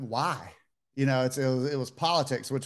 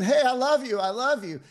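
a raised, excited shout across the street; screaming greeting; 10 semitones higher, 3 dB louder than usual. Retold or imitated. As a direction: call it out high and loud to someone passing. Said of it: "start screaming".

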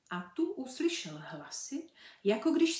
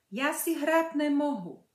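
startled, higher and louder refusal; taken aback; 6 semitones higher, 7 dB louder than usual. Acted. Birlibán refusing the girl, taken aback. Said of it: "odpověděl zaraženě Birlibán".

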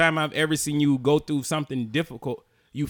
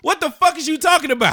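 loud exasperated shout; heated family shouting; 11 semitones higher, 5 dB louder than usual. Real, not acted. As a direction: yell in disbelief. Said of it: "Stop yelling at me".

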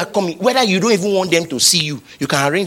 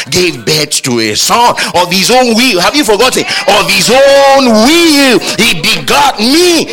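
louder and higher, slowing right down on of his own will; full-force preaching; 7 semitones higher, 8 dB louder than usual. Acted. Preacher reminding listeners they were begotten, slow loud climax. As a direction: hammer home the wonder of it.

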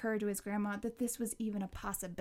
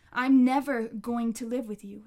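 louder, hard push on the refusal; 9 dB louder than usual. Acted. Connor refusing his grandma again, louder. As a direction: throw it at her, louder.

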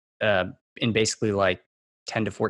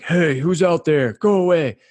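louder, slow, put-on warning shout; mock deep voice; 8 semitones higher, 7 dB louder, slower than usual. Warning off someone fiddling with the lock, mock deep voice. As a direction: imitate a scared teen trying to sound tough. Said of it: "using my deepest voice".

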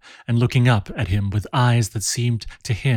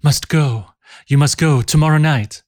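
higher and louder, urgent panting; 5 semitones higher, 5 dB louder than usual. Acted. Barbe to Jean after her run, panting and urgent. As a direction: push the words out out of breath from running. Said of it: "she panted".

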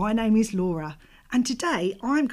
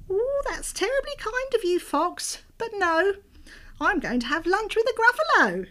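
high little mouse voice; 13 semitones higher, 4 dB louder than usual. Acted. Mouse voice in read story.

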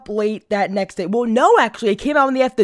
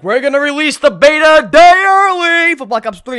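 loud announcement; 5 semitones higher, 10 dB louder than usual. Retold or imitated. Voicing the announcement.

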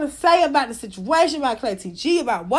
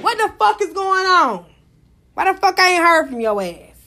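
usual speaking voice

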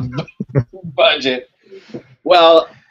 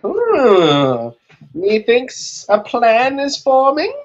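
scheming villain; 11 semitones higher, much slower than usual. Acted. Mock scheming about the episode.

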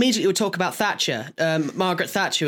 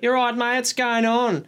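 higher Australian greeting, hammed up; mock Australian accent; 4 semitones higher than usual. Speaker imitating Australians for friends, mock Australian accent. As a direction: put on a matey Aussie voice.

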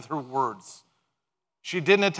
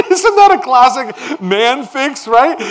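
higher, louder, slow laughing aside; 8 semitones higher, 10 dB louder, slower than usual. Real, not acted. Pastor laughing at the husband's fix, higher and louder. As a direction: chuckle through it, amused. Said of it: "I just laugh at that every single time".

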